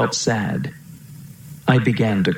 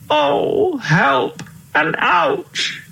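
very high, drawn-out plaintive wail; wailing cry; much slower than usual. A narrator's reading. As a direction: cry out in pain and distress, stretching each word. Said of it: "cried the king".

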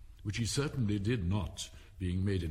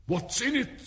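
high, louder imperious question; demanding Queen; 14 semitones higher, 5 dB louder than usual. A narrator's reading. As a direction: demand an answer in a high royal voice. Queen demands contents of paper.

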